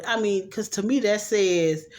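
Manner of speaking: normal talking voice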